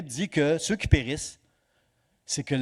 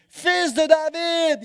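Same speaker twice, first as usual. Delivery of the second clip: high, slow, drawn-out cry of fils de David; blind man's cry; 13 semitones higher, 6 dB louder, much slower than usual. Retold or imitated. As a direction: call out across the crowd, pleading. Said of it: "il crie à Jésus".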